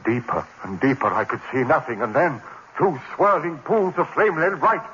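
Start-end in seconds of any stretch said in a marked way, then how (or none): 2.0-4.8 rising terror. Acted. Narrator watching burning eyes approach, building from uneasy to panicked. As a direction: let the fear rise with each phrase.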